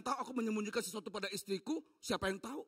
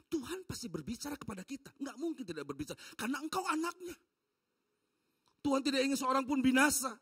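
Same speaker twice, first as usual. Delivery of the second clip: usual speaking voice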